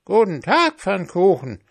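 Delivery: high, loud crowing rooster. A narrator's reading. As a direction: call out like a rooster. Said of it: "sagte der Gockel".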